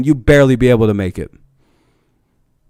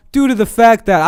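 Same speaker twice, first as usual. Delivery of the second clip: reading "Due to the fact that" raised and snide like a company notice; mock official reading; 9 semitones higher than usual. Shown as a demonstration. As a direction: sneer through the opening of the sign. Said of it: "I'm gonna correct this".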